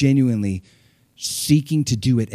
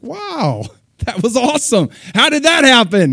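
much higher, laughing wonder; high, giddy astonishment; amazed delight; 10 semitones higher, 6 dB louder than usual. Retold or imitated. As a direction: gush like someone stunned by success. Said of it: "it's like".

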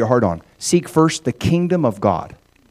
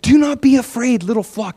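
higher, gentle and tender reassurance; tender Jesus; 9 semitones higher than usual. Acted. Jesus calming his anxious followers, tender and higher. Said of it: "can you hear the tenderness in Jesus' voice".

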